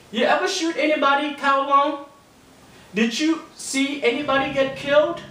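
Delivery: high, pushy schoolkid voice; mocking schoolyard interrogation; taunting kids. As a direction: needle him like a nosy bully kid. Kids interrogating the new kid.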